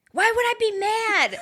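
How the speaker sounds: high squeaky imitation of the cousin; mock innocent cousin. Retelling how the cousin denied being mad, high and squeaky. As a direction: play it wide-eyed and falsely innocent.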